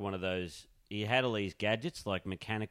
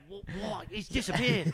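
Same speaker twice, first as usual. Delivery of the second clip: high-pitched astonished onlooker; mock bafflement; 11 semitones higher, slower than usual. Co-host plays an onlooker losing the man in a crowd, mock astonishment. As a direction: act stunned that someone has vanished.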